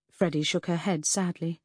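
plain reading voice

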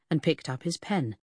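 ordinary delivery

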